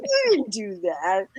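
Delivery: ordinary talk, nothing marked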